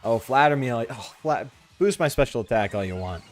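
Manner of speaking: usual speaking voice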